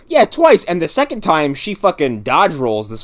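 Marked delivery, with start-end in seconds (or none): 0.1-0.6 high, loud emphatic word; excited. Real, not acted. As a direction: punch it out in disbelief. Echoing with emphasis.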